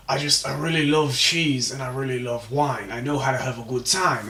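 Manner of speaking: lightly done British-sounding Shrek voice; British-slipping Shrek accent. Acted. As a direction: let the Shrek accent drift toward British. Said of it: "this is going British".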